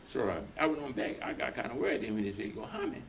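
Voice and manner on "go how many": high, soft quizzical question; surprised question. Retold or imitated. Boss asking how many are coming, high and soft. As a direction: pitch it up, soft and amazed. Boss asks in retold scene.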